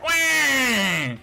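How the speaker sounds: high, loud playful cry; a hammed-up wail for help; mock scream. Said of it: "I'll just scream for help real loud".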